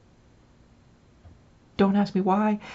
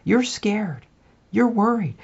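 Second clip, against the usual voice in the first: ordinary delivery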